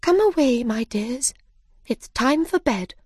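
high-pitched motherly call; high, lilting mother bird. Acted. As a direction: call the children in for the night. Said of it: "Another bird called to her children".

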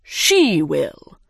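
lightly voiced dodo pronouncement; dodo character voice. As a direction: declare it with a long, falling first word. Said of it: "the dodo said".

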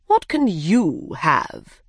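slow, deliberate dodo question; dodo character voice. Acted. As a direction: ask it ponderously, stretching the words. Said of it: "he asked".

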